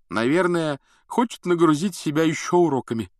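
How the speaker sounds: words through a yawn. A narrator's reading. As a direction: say it mid-yawn. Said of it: "зевая, сказал Рон".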